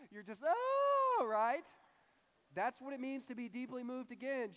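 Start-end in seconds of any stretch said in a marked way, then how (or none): 0.5-1.3 loud high dry-sob wail; play-acted crying howl; mock dry crying. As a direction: burst into a hammed-up tearless wail. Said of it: "now you're dry crying or drying".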